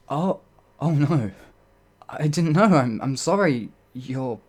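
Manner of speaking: loud, high, horrified cry of sorry; stammering, mortified outburst; panicked apology. Acted. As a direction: gasp out the apology in alarm. Realizes wrong royal title.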